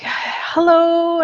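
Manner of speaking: high sing-song hello; imitating a girl saying hello; student greeting.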